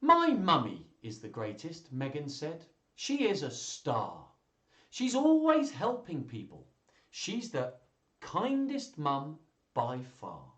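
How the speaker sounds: put-on slow, high-pitched reading to children; story read-aloud. Reader voices a child proudly praising her mummy, animated storytelling. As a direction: stretch out the key words with big pitch swings.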